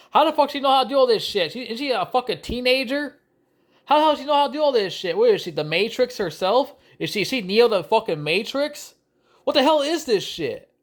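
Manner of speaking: ranting at the screen in disbelief; incredulous rant. Real, not acted. Angry viewer ranting about the movie, high and incredulous.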